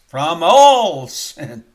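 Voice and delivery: very loud, very slow climactic cry; preacher's climax on the scripture refrain; thundering proclamation. Real, not acted. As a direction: shout it to the rafters, slowly.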